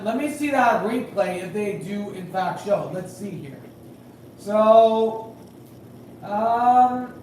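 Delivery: ordinary delivery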